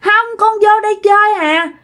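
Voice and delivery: put-on high-pitched child refusing to leave; little child's voice. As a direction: whine to stay and play, like a small kid. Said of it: "giọng một đứa con nít".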